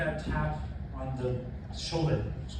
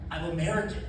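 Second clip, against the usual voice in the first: naive excitement; 4 semitones higher than usual. Retold or imitated. Excited retelling of own nickname.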